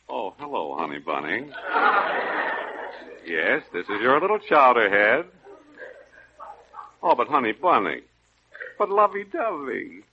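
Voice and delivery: the tough guy shrinks to a timid whisper of a husband; meek henpecked husband. Acted.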